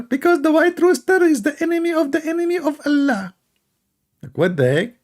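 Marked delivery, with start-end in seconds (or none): 0.0-3.3 high mocking uncle voice. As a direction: squeak out the uncle's answer in a high voice. Uncle's answer mocked.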